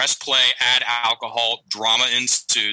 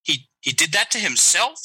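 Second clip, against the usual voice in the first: rising question, higher; 6 semitones higher than usual. Retold or imitated. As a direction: make "He did that to himself?" a wondering rising question. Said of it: "she said it like a question".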